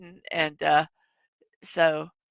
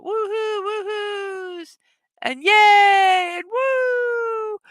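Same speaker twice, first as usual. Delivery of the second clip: squealing woohoos and yays, much higher; high excited cheering; 11 semitones higher, much slower than usual. Retold or imitated. Streamer echoing chat's cheers, high squealing woohoos. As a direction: cheer along with the chat, high and bright.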